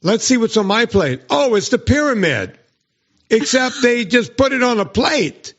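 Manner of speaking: high, sing-song sarcastic gush; high mock-cheerful sarcasm at the plate; sarcastic mock delight. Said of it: "So I went".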